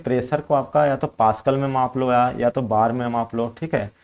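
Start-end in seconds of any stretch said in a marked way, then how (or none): none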